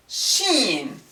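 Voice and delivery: ordinary delivery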